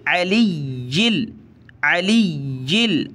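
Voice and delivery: "अलीयिल" with the double y pressed and held; firm doubled yaa. Shown as a demonstration. Teacher showing tashdeed, "अलीयिल" with a held y. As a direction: linger firmly on the y inside "अलीयिल". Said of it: "या को हमने जमा कर पढ़ना है".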